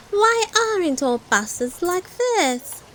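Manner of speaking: a hint of a small piggy girl; Peppa. A narrator's reading.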